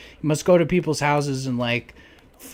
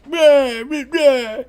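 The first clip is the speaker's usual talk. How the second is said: mock arguing gibberish; 12 semitones higher, 8 dB louder, much slower than usual. People arguing inside the house.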